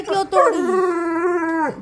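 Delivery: high, slow, drawn-out whine; dog's complaint. Pretend. Mother voicing her dog's slow whining complaint. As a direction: stretch it out like a sulking dog.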